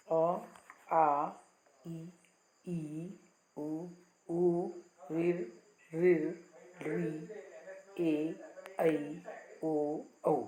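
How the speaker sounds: each vowel said slowly and separately, with a pause after each; teacher recites अ आ इ ई one by one for students; reciting the vowels. Shown as a demonstration. Teacher to class, reciting each vowel aloud with a pause. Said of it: "ये सब क्या हैं? स्वर हैं।".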